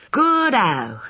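put-on high, gleeful boyish cheer; schoolboy delight. A narrator's reading.

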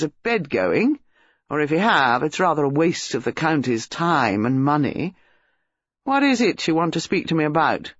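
put-on amused, offhand young Englishman; insolent young man. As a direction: needle the visiting policeman with lazy insolence.

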